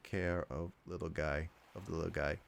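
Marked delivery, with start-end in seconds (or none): none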